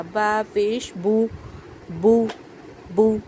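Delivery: बा पेश बू spelled out, बू with a pure oo; slow syllable drill. Shown as a demonstration. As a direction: drill बा पेश बू slowly, like a Quran teacher. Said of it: "ना ही ज़्यादा हमें लंबा करना है".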